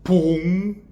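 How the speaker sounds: loud hollow boom; mock belly-drum boom; booming thump. Shown as a demonstration. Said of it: "that full jar of water sound".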